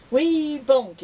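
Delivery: high, loud pronouncement; boy announcing the wrong spell; confident call. Acted. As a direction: call the word out proudly. Boy passes on wrong word.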